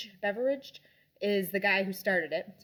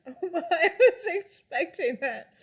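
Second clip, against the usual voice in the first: laughing gasp; 8 semitones higher, 7 dB louder than usual. Real, not acted.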